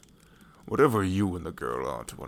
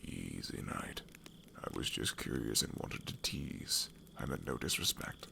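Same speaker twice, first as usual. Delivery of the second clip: hushed whisper; 6 semitones lower, 5 dB quieter than usual. Acted. Teasing the knight, then backing down.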